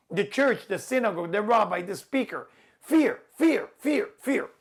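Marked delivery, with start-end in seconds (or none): 2.8-4.5 repeated shouted fear. Acted. Hammering the key word.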